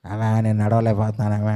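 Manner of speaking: low, soft mock complaint of a child; lightly voiced tired child. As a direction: lightly imitate a child too tired to walk. Child pleading it cannot walk.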